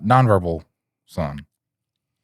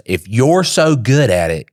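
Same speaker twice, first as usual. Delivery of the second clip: imitating an annoyed wife, louder and higher; raised, drawn-out retort; retold challenge; 6 semitones higher, 6 dB louder, slower than usual. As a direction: throw it back as a mock challenge. Wife challenges him in argument.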